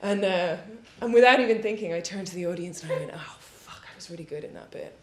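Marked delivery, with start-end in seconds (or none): 3.1-4.9 hushed, quick mock grumble; muttered aside. Stopped actress turning to the audience, quick muttered aside. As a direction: let it slip out under your breath.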